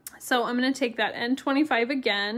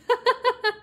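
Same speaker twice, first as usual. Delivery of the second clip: laugh; 12 semitones higher, 4 dB louder than usual. Real, not acted. Viewers chat, she laughs.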